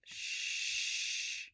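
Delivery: Sh as a long hiss; sound demo. Shown as a demonstration. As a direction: hold the Sh softly. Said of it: "Here's our sh sound".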